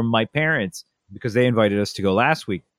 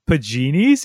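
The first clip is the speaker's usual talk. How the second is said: teasing; 6 semitones higher, 5 dB louder than usual. Retold or imitated. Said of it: "If he gets upset, I'm like".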